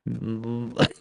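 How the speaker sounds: a muffled giggle held back; stifled laugh. Real, not acted. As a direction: try hard not to laugh, a snort slips out. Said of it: "sorry, I'm trying not to laugh".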